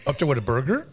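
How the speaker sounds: "burger" lifted at the end like a question; uptalk. Retold or imitated. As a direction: turn "Up there with a burger?" into a question with a rise on "burger". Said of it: "with the question".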